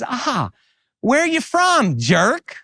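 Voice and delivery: high-pitched, sugary Southern retort; sweet Southern comeback. Acted.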